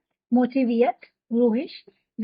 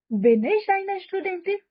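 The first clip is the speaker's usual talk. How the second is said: higher, slow asking tune on bin ich eine Studentin; question tune; 6 semitones higher, much slower than usual. Shown as a demonstration. Teacher modelling the question form for students, higher. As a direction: ask it, pitch lifted. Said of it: "क्या मैं हूँ".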